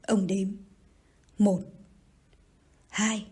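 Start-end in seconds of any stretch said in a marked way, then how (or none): 1.4-3.3 each number set apart, long pauses between; a measured, menacing countdown; deliberate count. A narrator's reading. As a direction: count it out one word at a time, as a threat. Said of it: "Rồi dằn từng tiếng, ông đếm".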